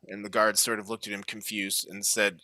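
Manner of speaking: ordinary talk, nothing marked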